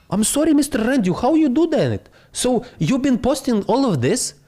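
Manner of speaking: high, fast fan voice, gushing; a hint of an awed fan; amazed follower.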